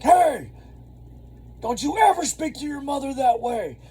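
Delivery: high, loud reenacted yelling; shouting down a mouthy kid; retold yell. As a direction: yell it at the rude teenager. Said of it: "I start yelling at this kid".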